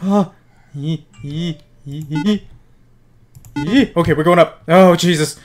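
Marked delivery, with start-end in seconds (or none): none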